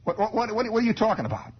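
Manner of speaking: high startled questioning; mother at the stove, startled and high; alarmed mother. Retold or imitated. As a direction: stammer out in shock.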